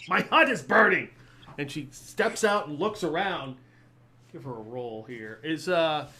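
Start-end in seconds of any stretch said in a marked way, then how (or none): 0.1-1.1 loud, higher-pitched hag outcry; alarmed hag. Acted. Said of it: "The hag goes".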